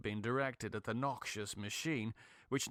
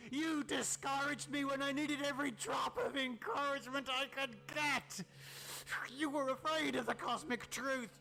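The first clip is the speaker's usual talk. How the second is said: gloating, menacing madman, higher than the narrator; mad scientist; 8 semitones higher than usual. Acted. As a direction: taunt the trapped friend with cruel triumph. Tillinghast gloats and threatens.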